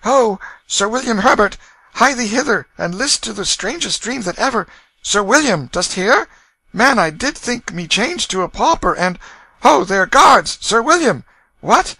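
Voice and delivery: loud, high royal summons; calling out. A narrator's reading. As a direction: call for your servants loudly. Prince calls for servants.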